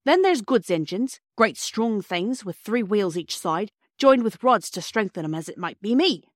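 put-on a proud cockney porter lecturing children. Porter Perks explaining engines to the children in a hearty Cockney accent.

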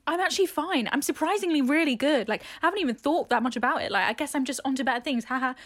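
put-on "I'm totally fine" voice; pretending not to care. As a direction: act breezily over a breakup, a bit too keenly. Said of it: "you have to pretend that you don't care".

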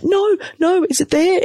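high, squeaky dread, retold; shock horror. Mother afraid to look at her baby, in shock horror. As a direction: squeak it in acted-out alarm. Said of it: "I'm in shock horror".

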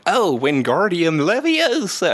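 high, slow fake English accent; Harry Potter spell in a put-on British accent; American doing a posh British accent; mock British accent. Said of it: "weird hybrid accents".

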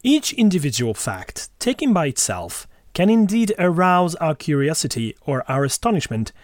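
measured, formal quote reading; reciting a scientist's words. Acted. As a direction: read it out like a passage from a book. Reads a quote aloud.

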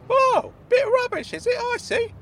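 high-pitched mock offence; offended Claude. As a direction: sound put out in a high squeak.

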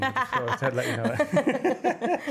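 high, slow, giggly aside; a teasing, laughing afterthought; laughing joke. Real, not acted. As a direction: let the laugh carry the line up high. Joking that the podcast is therapy.

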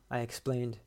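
plain reading voice

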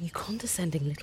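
furious hissed whisper; angry whisper. Acted. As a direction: spit it out under your breath. Insulting partner under breath.

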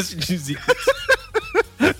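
words through high laughter; giggling sign-off; laughing. Real, not acted. Laughing sign-off to absent friend.

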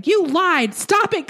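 high mock shriek at her daughter; imitated screaming. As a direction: shriek it like the mother losing it. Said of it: "I could hear Andrea screaming".

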